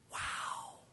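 breathless whispered wow; awed whisper. Real, not acted. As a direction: whisper it, stunned. Reacting to a heavy verse.

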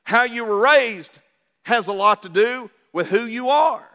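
normal talking voice

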